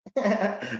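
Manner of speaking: short high-pitched laugh; sudden amused cackle. Real, not acted. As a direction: burst out in a brief high chuckle. Amused by his own digression.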